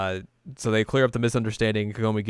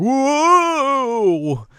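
loud, very high, long-held whoa; hammed-up cry of alarm; startled whoa; 8 dB louder than usual. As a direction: let it soar up and hang, then fall. Acts Kagome's startled cry.

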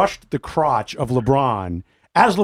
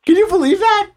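incredulous high exclaim; 4 dB louder than usual. Real, not acted. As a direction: pitch jumps up in disbelief. Outraged disbelief at the suspension.

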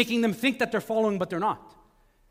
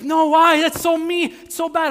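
higher, louder wife's protest; 7 semitones higher, 8 dB louder than usual. Retold or imitated. Wife objecting to a pet snake, high and loud. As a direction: squeal it as the shocked wife. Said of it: "She's like".

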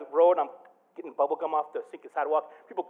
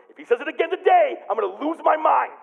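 exasperated vent; 10 semitones higher, 7 dB louder than usual. Retold or imitated. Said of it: "I tell Angela".

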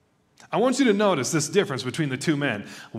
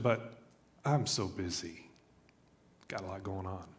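trailing off into a low, soft mumble; a mock-sheepish mutter, fading away; mumbled excuse; 7 semitones lower, 11 dB quieter than usual. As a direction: shrug it off quietly, like a weak excuse. Acting out a busy-person excuse.

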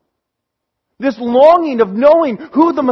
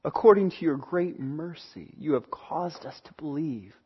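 much softer and lower, reverent prayer voice; closing prayer; 10 semitones lower, 12 dB quieter than usual. Real, not acted. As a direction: pray quietly before God. Pastor leads closing prayer.

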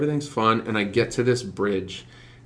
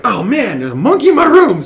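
high, loud, laughing panic; laughing alarm; 13 semitones higher, 9 dB louder than usual. Retold or imitated. Retelling finding a monkey in his room, laughing high alarm. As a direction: squeal it out through a laugh.

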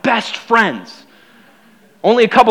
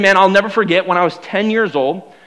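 ordinary talk, nothing marked

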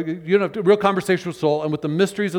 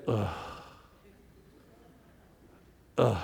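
long weary groan, drawn out twice; a groaning "ugh" from deep down; 8 semitones lower, 10 dB quieter than usual. Shown as a demonstration. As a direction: let out a heavy, wordless groan. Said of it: "The word today is".

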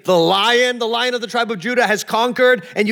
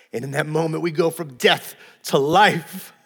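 ordinary talk

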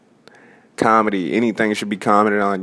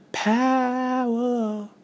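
"Power" stretched long and much higher, softer; drawn-out high "Power"; 5 dB quieter than usual. Shown as a demonstration. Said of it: "repeats the word power".

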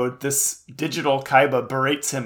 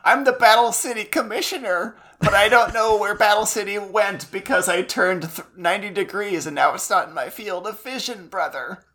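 put-on high, clueless kid voice; childlike voice; 7 semitones higher than usual. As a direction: sound like a confused little boy. Imitating clueless Mokuba.

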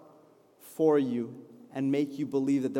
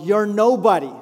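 loud, raised mock jeer; imitated yelling; 5 semitones higher, 11 dB louder than usual. Preacher voices people yelling at the discouraged, imitated yell. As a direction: call it out like a crowd yelling. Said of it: "people are yelling out".

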